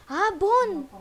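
high, loud burst of delight; excited find. Real, not acted. Player finding a bone, excited. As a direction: exclaim at a sudden lucky find.

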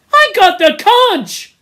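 high, loud, slow protest; cling to your right to speak; Piggy crying out over the noise; desperate insistence. Acted.

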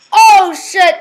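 loud, high startled curse; alarmed exclamation. Real, not acted. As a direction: blurt it out in alarm. Sudden mishap in the game.

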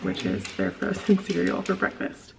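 usual speaking voice

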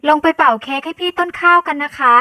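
put-on high, loud little-girl call; shouting. A narrator's reading. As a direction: holler an invitation to uncle upstairs. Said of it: "ต้นน้ำตะโกนต่อจากพี่ชาย". (ตะโกนเรียกเสียงสูง)

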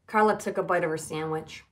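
usual speaking voice

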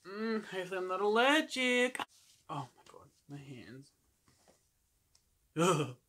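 speaking through facial sheet mask; wearing a sheet mask. Real, not acted. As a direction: lips framed by wet mask. Seen 1: Printed sheet mask covers face and chin throughout; mouth opening visible.

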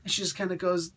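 usual speaking voice

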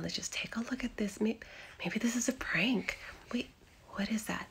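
fast nervous whisper. Acted. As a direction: breathe it out quickly. Nervously inspecting.

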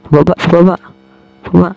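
usual speaking voice

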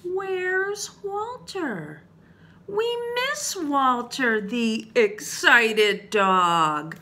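drawn-out storybook pining; wondering. Acted. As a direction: wonder aloud as the missing townsfolk. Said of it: "started to wonder".

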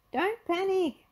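high, urgent call; high-pitched warning call; Velma calling out. Acted. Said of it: "Velma called".

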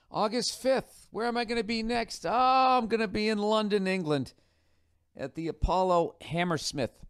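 high, excited tour-date call; hammed-up announcement. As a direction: build mock suspense, then proclaim it high. Mock-excited announcing of next tour city.

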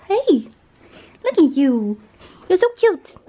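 squeaky high pet voice. Real, not acted. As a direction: coo at the puppy, high. Cooing at her dog.

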